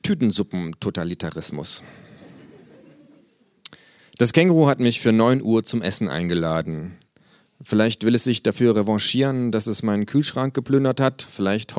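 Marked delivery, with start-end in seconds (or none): none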